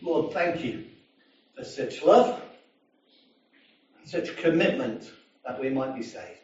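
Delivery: lightly voiced closing prayer. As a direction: pray it simply to God.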